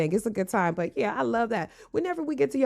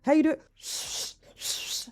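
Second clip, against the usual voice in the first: hushed shh, almost no voice; playful whispered shushing; 7 semitones higher, 5 dB quieter than usual. Pretend. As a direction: shush them softly like lovers. Couple shushing in the rain.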